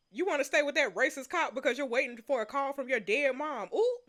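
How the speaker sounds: normal talking voice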